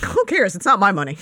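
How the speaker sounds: loud, laughing shrug-off; laughing dismissal. Real, not acted. Laughs off losing audience.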